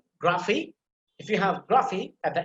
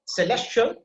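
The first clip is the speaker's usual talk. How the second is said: "celestial" with LES lifted higher; stress on second syllable; 6 semitones higher, 3 dB louder than usual. Shown as a demonstration. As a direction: lean on the middle of "celestial". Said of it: "you have to put emphasis there".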